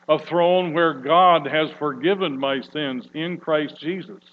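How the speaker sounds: ordinary talk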